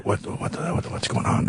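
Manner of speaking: hushed, breathless whisper asking the translator; whispered question. Retold or imitated. Visitor whispers to his translator amid weeping crowds, hushed whisper. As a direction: whisper it urgently, almost no voice. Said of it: "And I was going".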